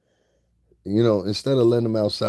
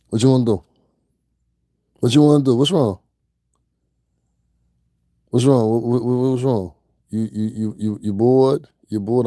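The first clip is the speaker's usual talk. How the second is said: loud, higher goading shouts; yelling at chat to bring it on; taunting yell; 4 semitones higher, 8 dB louder than usual. Real, not acted. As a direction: bark challenges to pick a fight.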